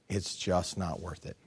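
very soft, low and slow; hushed mock despair; defeated. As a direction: sigh it out from under the covers. Hiding under the comforter, giving up.

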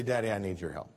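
small tired ask for help; child giving up. Retold or imitated. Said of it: "And then they say".

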